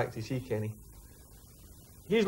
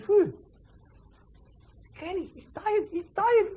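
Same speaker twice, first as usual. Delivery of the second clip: mock shock; slower than usual. [name] and [name] exchange shock.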